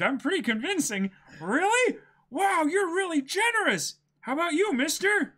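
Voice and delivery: high, loud, eager Luffy voice; hammed-up boyish Luffy. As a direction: play the excited cartoon hero. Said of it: "Luffy's like".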